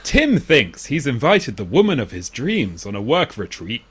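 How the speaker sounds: swooping, theatrical trailer-style read; plot synopsis read. Real, not acted. Podcaster reading a film synopsis to co-hosts, theatrical read. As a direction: read it out with big pitch swings.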